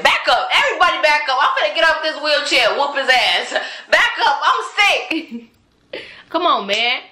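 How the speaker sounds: loud, high laughing mock threat. Pretending to square up to the young man in the hospital, laughing loud mock threat. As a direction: yell it like you're about to jump out of the wheelchair, half laughing.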